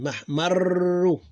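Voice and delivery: mahmarru with a long rolled r; doubled r. Shown as a demonstration. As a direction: roll the r long in mahmarru, slowly. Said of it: "pronounced like two of them".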